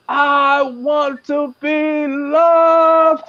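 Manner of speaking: high, very slow and drawn-out "I want to be loved"; timid woman. Pretend. Woman scared to say what she wants, high and drawn out. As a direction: play a shy woman, stretching "loved" very slowly. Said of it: "I'm gonna start mumbling it".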